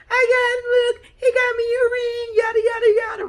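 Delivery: very high, loud gushing delight; giddy excited. Retold or imitated. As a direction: gush to the family, voice way up. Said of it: "She points at".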